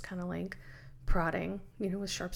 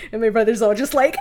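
ordinary delivery

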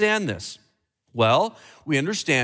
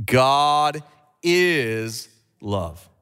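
God ... is ... love, drawn out slowly; 4 semitones lower, much slower than usual. Shown as a demonstration. Preacher repeating God is love very slowly with church. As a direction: stretch every word of God is love. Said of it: "Let's do it again".

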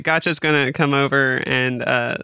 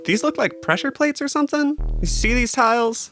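high, hushed adventurer; high character voice; 10 semitones higher than usual. Acted. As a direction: point out the tiles warily. Gotcha in character voice.

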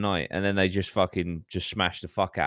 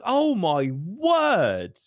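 slow, higher, drawn-out exasperation; appalled slow sigh of words; disbelief; 8 semitones higher, much slower than usual. Retold or imitated. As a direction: stretch it out in disbelief. Disbelief at dropped case.

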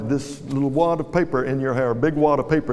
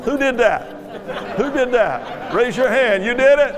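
amused scolding; 7 semitones higher, slower than usual. Real, not acted. Someone throws the paper too early.

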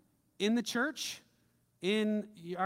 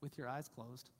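quiet prayer lead; 5 semitones lower, 8 dB quieter than usual. Acted.

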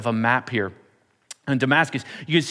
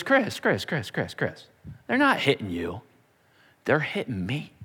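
softening, quieter calling of a name; tender reassurance; 2 semitones lower, 4 dB quieter than usual. Acted. Jesus consoling Chris when he is persecuted, soft and tender. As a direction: comfort him gently.

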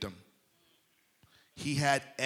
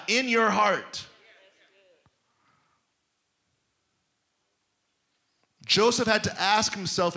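raised, urgent pulpit voice; preaching at full force; 5 semitones higher, 3 dB louder than usual. Acted. Preacher pressing his church toward a higher level, fervent preaching. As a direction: drive it home to the congregation.

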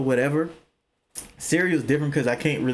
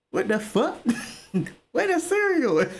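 surprised; 10 semitones higher than usual. Retold or imitated. Finds cereal missing.

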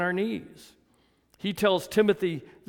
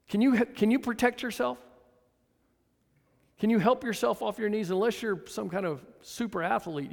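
ordinary talk

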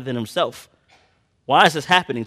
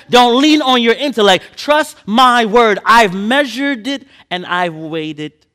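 high, loud, slow reassurance from God; God speaking; 9 semitones higher, 7 dB louder than usual. Acted. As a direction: proclaim it as a tender but forceful divine promise. Preacher voices God reassuring.

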